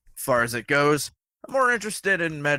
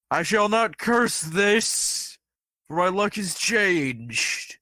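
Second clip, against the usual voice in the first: dramatic declaration; 8 semitones higher, slower than usual. Acted. Player speaking as character, slow high.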